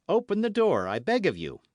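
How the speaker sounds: high, loud call easing off; urgent plea. Acted. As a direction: beg through a closed door.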